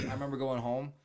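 ordinary talk

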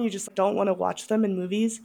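normal talking voice

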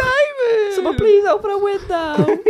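high, crying with laughter; laughing. Real, not acted. Mate begs someone to open a window, laughing. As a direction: howl it out between laughs. Group cracking up, shouting.

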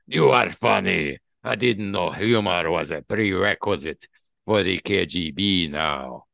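hammed-up Russian villain, forceful; Russian accent, sizzling oil. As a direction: declaim it with a heavy Russian accent, slowing for menace. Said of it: "your Russian accent to sizzling oil".

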